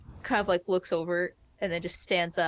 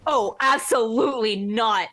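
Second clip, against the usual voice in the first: loud, raised-pitch refusal; forceful objection; 4 semitones higher, 9 dB louder than usual. Acted. Player objecting as she walks over to the others, forceful. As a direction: refuse loudly and emphatically.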